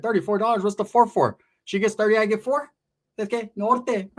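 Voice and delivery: high-pitched incredulous haggling; mock disbelief. Storyteller replays haggling with the old man, mock incredulous. Acted haggling over thirty-four dollars.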